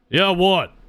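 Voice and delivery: chef snapping back. Acted. Chef answers the barkeep.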